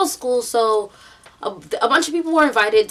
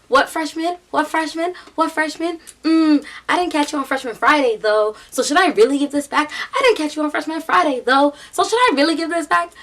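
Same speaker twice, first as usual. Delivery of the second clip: high-pitched mock senior sister; teasing taunt; 6 semitones higher than usual. Senior sister holding a freshman's skateboard, taunting. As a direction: needle him with repeated questions, imitating her.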